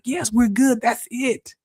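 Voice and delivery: high-pitched triumph; excited relief. Retold or imitated. Middle mind confirming the breakthrough, high and excited. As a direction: declare it solved, pitched well up. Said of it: "I'm like".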